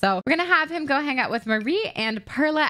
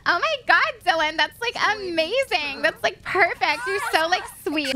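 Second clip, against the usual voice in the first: high, gushing acted praise; thrilled friend; 7 semitones higher than usual. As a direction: play a delighted friend, pitched well up. Voicing thrilled friend accepting coming-out.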